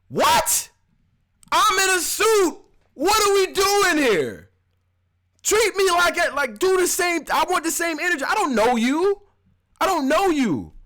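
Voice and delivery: indignant man in a suit, voice jumped up high. Retold or imitated. Man in a suit scolding the cashier, indignant high voice. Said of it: "I'm the type to be like".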